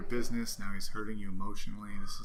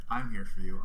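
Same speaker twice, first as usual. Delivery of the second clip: louder, pressing comfort; emphatic reassurance; 8 dB louder than usual. Real, not acted. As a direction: push the promise out strongly. Comforting the upset partner.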